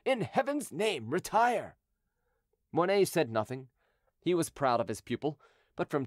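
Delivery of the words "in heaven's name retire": high-pitched urgent plea; crying out. A narrator's reading. Chicot urging the king back, crying out. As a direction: beg him, voice raised in pitch. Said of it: "cried Chicot".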